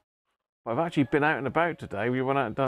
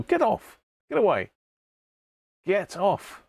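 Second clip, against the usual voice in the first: mock scolding; 8 semitones higher than usual. Pretending to fend off the penguin that won't leave. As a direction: shoo the clingy puppet away in jest. Playfully pushing away clingy puppet.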